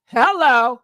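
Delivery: ordinary talk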